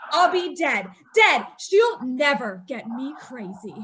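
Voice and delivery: ordinary delivery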